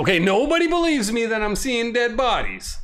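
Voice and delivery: exasperated, pleading; nobody believes me, high and aggrieved; exasperated whine. Real, not acted. Whining nobody believes her.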